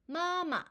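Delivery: 마마 with a high held first syllable, short drop on the second; high level 마, then brief falling 마; first tone plus neutral tone. Shown as a demonstration. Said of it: "다시 한번 해볼까요".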